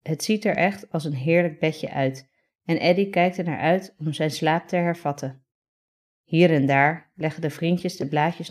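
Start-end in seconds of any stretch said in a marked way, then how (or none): none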